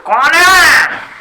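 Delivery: long, drawn-out shouted question; calling back. Acted. Elder answering a caller outside, shouting back. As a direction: stretch it out as a shout to the door.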